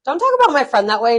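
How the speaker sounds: mock scolding. Retold scolding of her friend.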